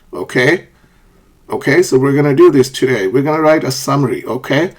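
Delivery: "okay" lifted at the end as a check; brisk teacher talk, each tag "okay" rising; upward intonation. Shown as a demonstration. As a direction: turn "okay" upward, seeking agreement. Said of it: "Upward intonation in short responses".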